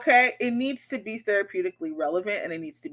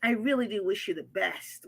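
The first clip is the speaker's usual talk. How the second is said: passive aggressive; 6 dB quieter than usual. Retold or imitated. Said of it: "she ends passive aggressively".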